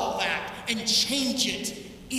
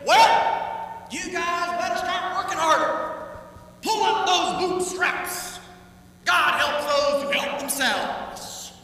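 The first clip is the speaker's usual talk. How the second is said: loud, high hammed-up lecture; mock scolding Jesus; 6 semitones higher, 5 dB louder than usual. Pastor mocks a Jesus who says work harder, in hammed-up scolding. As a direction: scold them, sarcastic. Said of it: "Jesus does not say".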